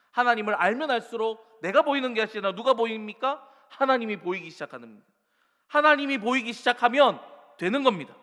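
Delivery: full-force sermon prayer; fervent preaching. Acted. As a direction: pour out the prayer from the pulpit. Preaching climax.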